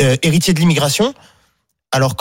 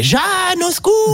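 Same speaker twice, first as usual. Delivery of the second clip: imitated cry for help. Shown as a demonstration. Guest demonstrating his Jean-Marie Le Pen imitation on request, crying out. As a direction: cry it out like a theatrical plea to a statue. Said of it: "on voudrait voir l'imitation".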